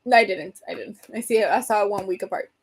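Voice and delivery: ordinary talk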